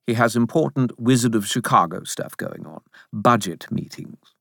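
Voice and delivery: lightly voiced self-important spirit; hint of an officious character; smug Bob. A narrator's reading. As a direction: explain it with mild superiority. Bob explains to the narrator.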